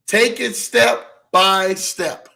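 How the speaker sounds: "Take it step by step" dragged out very slowly, each word laid down. Shown as a demonstration.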